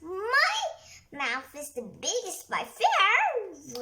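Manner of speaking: loud, slow bragging roar. Acted. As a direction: boom it out to win. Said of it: "roared Rhino".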